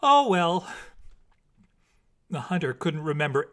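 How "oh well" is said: high, loud cheerful "Oh, well"; cheerful exclaim. A narrator's reading. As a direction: toss it off lightly. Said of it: "she would exclaim cheerfully".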